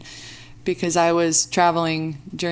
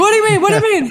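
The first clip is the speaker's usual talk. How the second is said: high, loud indignant What do you mean; playfully indignant, loud and high; mock outrage; 14 semitones higher, 6 dB louder, faster than usual. Real, not acted. Mock-outraged about coffee.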